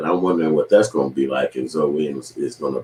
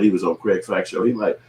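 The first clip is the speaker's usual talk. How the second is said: ordinary talk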